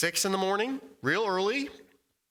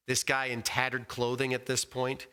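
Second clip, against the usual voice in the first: normal talking voice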